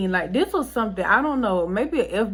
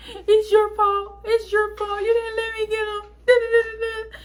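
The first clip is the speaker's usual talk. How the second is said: high-pitched wailed accusation; exaggerated tearful whine, far above normal pitch; mock crying wail; 13 semitones higher than usual. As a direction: imitate yourself bawling blame at someone. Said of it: "I cried hard tears about that".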